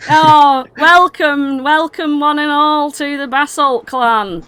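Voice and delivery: loud, high, drawn-out welcome; hammed-up hospitality to the whole crowd; put-on grand welcome. Clan leader obliged to host strangers, effusive put-on welcome. Forced hospitality to unwanted guests.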